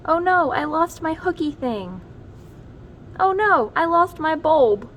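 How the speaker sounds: high, rising alarm; mock panic. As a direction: yelp in mock dismay. Crayons losing ornaments.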